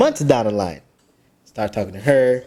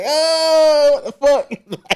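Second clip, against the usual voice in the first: high, drawn-out "oh" of dread; mock dismay; much slower than usual. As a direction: imitate a man caught out, pitched up. Acted dismay at finding girlfriend.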